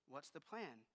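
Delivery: low, soft answer; calm reply. Retold or imitated. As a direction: answer quietly, steadily. Objector versus God's answer.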